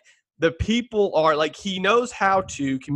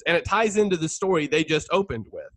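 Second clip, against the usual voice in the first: ordinary talk, nothing marked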